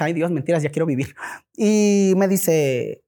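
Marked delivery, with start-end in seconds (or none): none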